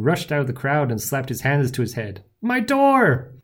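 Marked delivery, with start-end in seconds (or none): 2.4-3.3 very high, loud wail; anguished outcry. A narrator's reading. Baker sees cracked glass door, anguished outcry. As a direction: cry out over ruined property.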